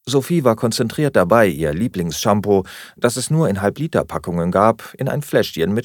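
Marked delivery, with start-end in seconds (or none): none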